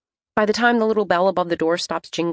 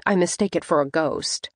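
ordinary delivery, nothing marked

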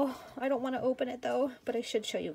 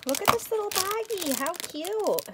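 much higher, delighted coo; high squeaky gushing; 9 semitones higher than usual. Real, not acted. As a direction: gush over the tiny bag. Cooing over little baggie.

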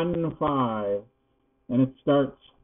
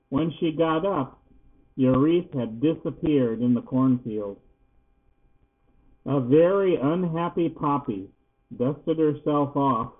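ordinary delivery

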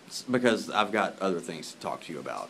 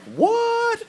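squeaky high, loud "What?"; shocked neighbor; 9 dB louder than usual. Retold or imitated. Neighbor reacting to the repo, high squealing shock. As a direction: squeal it in disbelief. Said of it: "My neighbor was like".

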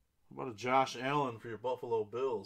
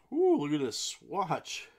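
loud, higher burst of excitement; excited reaction; 4 semitones higher, 9 dB louder than usual. Real, not acted. Breaker showing buyers a swatch, excited. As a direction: open with a big admiring ooh.

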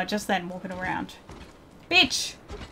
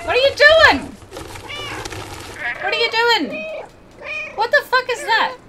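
shocked yelling; 12 semitones higher, 4 dB louder than usual. Real, not acted. Gamer yelling at a game robot.

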